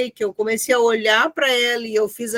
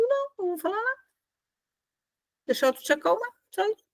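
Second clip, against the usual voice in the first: soft, higher-pitched, unargumentative answer; quiet reply; 9 semitones higher, 9 dB quieter, much faster than usual. Retold or imitated. Daughter answering someone who was shouting, quiet and calming. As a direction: answer gently with long pauses, refusing to fight. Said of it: "Aí eu comecei a ficar quieta".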